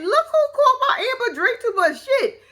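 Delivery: high, loud, laughing comeback; laughing retort. Retold or imitated. Mocking critics of Amber, laughing high and loud. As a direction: toss it back through laughter.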